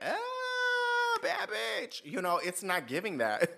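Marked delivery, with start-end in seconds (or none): 0.0-2.0 very high shouted admiration; delighted squeal. Pretend. Scene: foreigner reacting to an American, high shouted delight. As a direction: gush over her. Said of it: "I'd be like".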